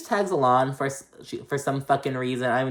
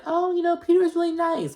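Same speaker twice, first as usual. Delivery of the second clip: high, sugary imitation of a gushing friend; mocking girly gush. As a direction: parody her sweet-talk way up high. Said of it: "Ashley's like".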